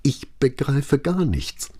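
high, very slow, straining to stay calm; trembling. A narrator's reading. Maria bewildered before Brunetti, trembling with effort. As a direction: fight to speak calmly through trembling. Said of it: "die regelrecht zitterte von der Anstrengung, ruhig zu sprechen".

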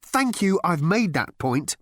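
plain reading voice

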